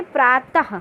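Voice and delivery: प्रातः said slowly with a breathy ha at the end; visarga ha. Shown as a demonstration. Teacher demonstrating visarga to students. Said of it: "हा का उच्चारण हुआ".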